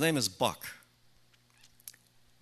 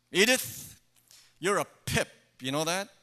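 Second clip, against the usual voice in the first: disgusted Archie; 5 semitones higher, faster than usual. Retold or imitated. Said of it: "with this disgusted look on his face".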